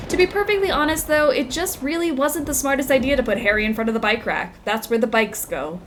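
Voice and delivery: newspaper article read aloud; news read. Acted. Reads in-game news article.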